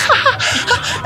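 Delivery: mock giggle. Imitating laughing guy next to him.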